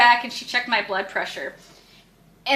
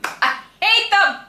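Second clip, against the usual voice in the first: slow emphatic hate; 9 semitones higher, slower than usual. Real, not acted. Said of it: "God, I fucking hate them".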